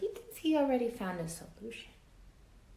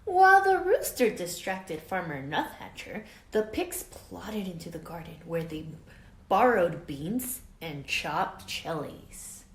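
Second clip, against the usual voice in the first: put-on lively read-aloud for children; story narration; 10 dB louder, slower than usual. A narrator's reading. Teacher reading a storybook to her class, lively read-aloud. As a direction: tell it like a picture book to kids. Reads picture book to class.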